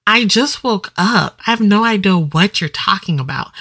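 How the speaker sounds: usual speaking voice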